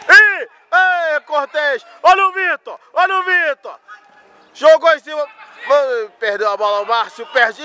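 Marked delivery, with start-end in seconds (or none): 0.1-5.3 frantic play-by-play. Real, not acted. Frantic live action calls.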